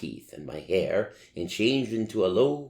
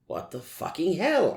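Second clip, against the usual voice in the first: angry; 10 semitones higher than usual. A narrator's reading. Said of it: "I asked angrily".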